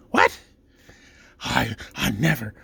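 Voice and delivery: mock-scandalized gatekeeper, slow and halting; hammed-up taken aback; affronted shock. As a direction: sputter as if deeply offended, then gasp.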